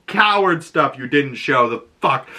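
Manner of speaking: ordinary talk, nothing marked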